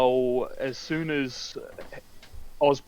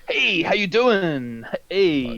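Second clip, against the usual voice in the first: drawn-out, higher mock greeting; imitating Osborn; 6 semitones higher, 3 dB louder, much slower than usual. Osborn turning up to greet them, hammed-up drawl. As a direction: stretch out a hammy, high hello. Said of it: "he's like".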